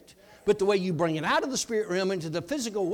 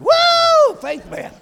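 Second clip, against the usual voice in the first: high loud hero whoop; triumphant whoop; 12 dB louder, much slower than usual. Retold or imitated. Preacher playing Faith Man in the phone booth, whooping. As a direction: shout it like a superhero.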